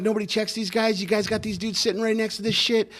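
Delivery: high-pitched retold chewing-out; hammering them, acted out; mock scolding. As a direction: imitate a supervisor tearing into the crew, volume held back. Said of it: "He fucking just hammered those guys".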